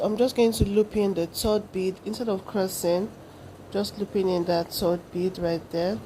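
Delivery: ordinary delivery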